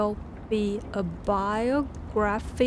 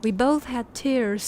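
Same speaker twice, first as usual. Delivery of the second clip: ordinary delivery, nothing marked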